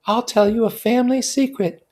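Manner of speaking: high, loud eager confiding; high excited confiding; enthusiastic whisper. A narrator's reading. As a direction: lean in with gossip. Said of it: "she whispered enthusiastically".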